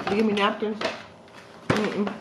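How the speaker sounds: talking between bites; chewing while talking. Real, not acted. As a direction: mouth partly full. Seen 0: Lips pursed, jaw working after biting slider; chewing continues through words.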